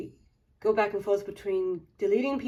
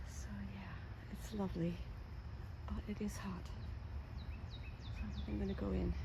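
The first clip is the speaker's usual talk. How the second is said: whispering; 13 dB quieter than usual. Real, not acted. Sudden whisper.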